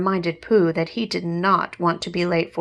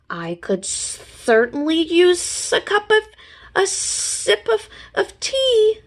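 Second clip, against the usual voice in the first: Gopher voice; 11 semitones higher, 4 dB louder than usual. Acted. Gopher voice, stumbling.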